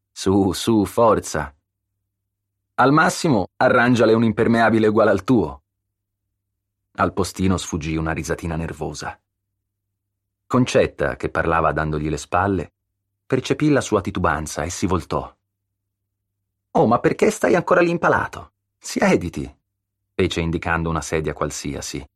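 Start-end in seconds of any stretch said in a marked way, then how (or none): 0.1-5.6 lightly voiced brisk encouragement, rising louder; playful urging, higher and louder at the end; teasing, coaxing hostess. A narrator's reading. Concetta jokingly urges him on. 16.7-19.6 high, loud chiding question, then a firm order; friendly exasperation. A narrator's reading. Concetta chides guest for standing around.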